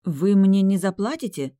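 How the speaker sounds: annoyed and a bit loud; displeased. A narrator's reading. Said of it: "Недовольно повернулась я".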